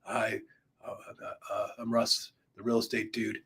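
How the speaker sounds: groggy, low, soft mumble with hesitations; just woke up. Shown as a demonstration. Coach demoing a first video intro, groggy after a nap. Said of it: "like you just woke up from a nap".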